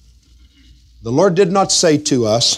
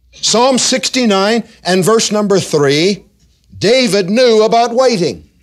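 rising, louder preacher's cadence, pitched up; preaching; 11 semitones higher, 5 dB louder than usual. Acted. Pastor exhorting his congregation, full preaching voice.

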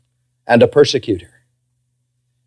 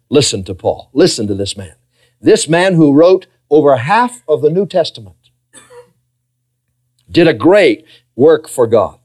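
ordinary delivery

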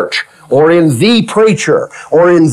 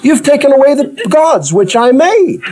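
voicing Micah; 9 semitones higher than usual. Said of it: "he says".

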